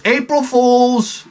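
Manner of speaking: high, slow singsong April Fool's; kid gotcha voice. Retold or imitated. Son teasing his dad, high and slow. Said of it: "he goes".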